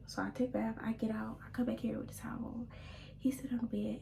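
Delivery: ordinary delivery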